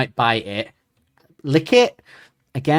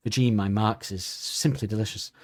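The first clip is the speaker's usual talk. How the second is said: soft, lower mock ad voiceover; mock food advert; 4 semitones lower, 7 dB quieter than usual. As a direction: purr it like a parody food advert, softly.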